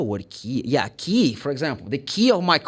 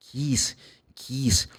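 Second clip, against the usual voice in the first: ordinary talk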